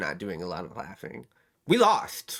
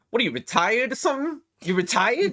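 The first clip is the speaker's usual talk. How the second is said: higher, teasing question, a hint of a jeering driver; mocking young guy; 10 semitones higher than usual. Retelling a TikTok, guy taunts an old driver, teasing. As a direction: needle him about being retired. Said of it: "this dude goes".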